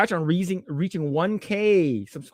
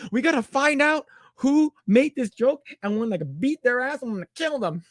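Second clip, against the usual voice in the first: high-pitched mock fury; pissed off; 9 semitones higher than usual. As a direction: act out an outraged threat against the joke's author. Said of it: "He gets really pissed off".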